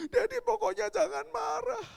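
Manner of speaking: high, loud tearful insistence; mock childish wail; imitated pleading child. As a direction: beg father like a upset kid. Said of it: "Sudah nangis dia".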